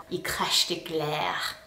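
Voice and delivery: slow, hushed whisper; grossed-out slow whisper. Shown as a demonstration. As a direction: whisper it slowly as if it's too gross to say aloud. Whispering a disgusting word.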